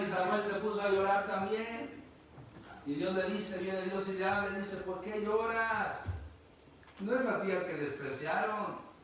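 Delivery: loud, high, fervent preacher's cry; preaching at full force. Acted. Predicador clama a la congregación, a toda voz. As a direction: lift the congregation with God's call.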